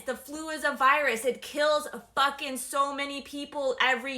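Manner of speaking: loud furious yelling; furious shouting. Real, not acted. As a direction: yell it at the viewers. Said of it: "if I sound angry".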